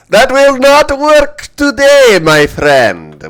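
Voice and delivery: put-on loud, high Russian accent. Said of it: "As to why I'm doing a Russian accent".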